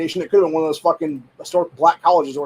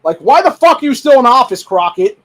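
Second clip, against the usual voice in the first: much higher and louder, yelling at her; furious shout; 8 semitones higher, 8 dB louder than usual. Real, not acted. Ranting at the congresswoman, furious shout. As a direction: blast the question at her in outrage.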